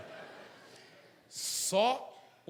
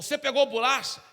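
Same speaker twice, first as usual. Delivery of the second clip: stern mother; 7 semitones higher, 9 dB louder than usual. Acted. Mother questions child.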